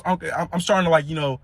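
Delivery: ordinary talk, nothing marked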